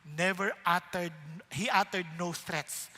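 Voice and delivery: normal talking voice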